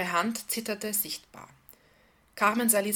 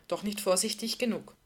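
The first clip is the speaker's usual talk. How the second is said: usual speaking voice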